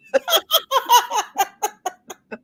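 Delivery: long, high peal of laughter; real high-pitched giggling fit; cracking up. Real, not acted. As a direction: burst out laughing at a silly idea. Cracks up at blaming the shirt.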